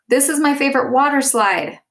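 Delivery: high, loud excited exclamation; exclaimed. Shown as a demonstration. Teacher reading a student's exclamation, excited. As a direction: exclaim it with delight. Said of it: "I exclaimed".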